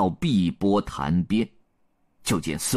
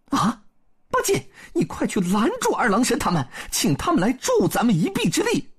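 high-pitched, urgent Monkey King; 孙悟空 urging; 12 semitones higher, faster than usual. A narrator's reading. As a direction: send a companion off in a high, eager voice. (高亢急切的孙悟空)

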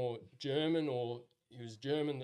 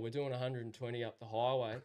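normal talking voice

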